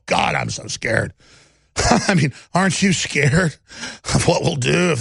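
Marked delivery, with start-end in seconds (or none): 0.1-1.1 mock fear. Mocking fear of opponents.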